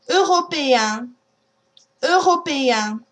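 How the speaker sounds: "Européen" ending nasal, no N. Shown as a demonstration. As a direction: finish "Européen" in the nose. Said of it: "Try a few words".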